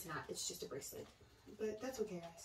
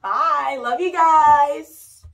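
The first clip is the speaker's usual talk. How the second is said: much louder, slow, stretched-out farewell; loud drawn-out goodbye; 6 semitones higher, 18 dB louder, much slower than usual. Real, not acted. Daughter signing off her haul video to viewers, loud and drawn out. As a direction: call it out big and slow to the viewers.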